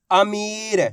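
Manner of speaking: "अमीर" with the मी stretched; drawn-out vowel. Shown as a demonstration. Teacher repeating the word for learners, "अमीर" with long ई. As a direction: draw out the ई of "अमीर". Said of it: "मैं किस तरीके से एक अक्षर का उच्चारण".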